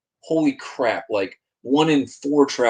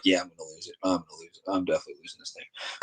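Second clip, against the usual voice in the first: softer, lower, gloomy mutter to himself; resigned pessimism; 5 semitones lower, 13 dB quieter, faster than usual. Retold or imitated. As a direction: say it like you've already lost the fish. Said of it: "the whole time I'm like".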